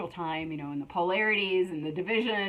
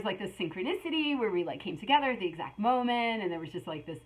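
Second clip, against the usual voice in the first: usual speaking voice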